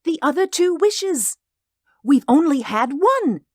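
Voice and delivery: put-on very high, loud excited old woman; hysterical eagerness. A narrator's reading. Wife explaining the two wishes left, hysterical. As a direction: press the point frantically. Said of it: "she said hysterically".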